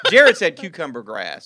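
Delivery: laughing through the words; slow, loud, laughing. Real, not acted. Laughing at the answer.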